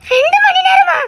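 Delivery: startled and alarmed; shocked disbelief. Acted. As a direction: echo it back, aghast. Shocked echo of two hours missing.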